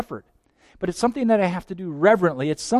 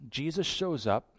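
usual speaking voice

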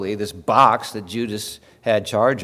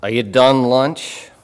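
slow, louder Pennsylvania "Are you done lunch?" with a rising end on "done lunch"; 3 semitones higher, 9 dB louder, slower than usual. Retold or imitated. Pennsylvania locals asking the preacher about lunch, slow loud Pennsylvania phrasing. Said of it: "people say things like".

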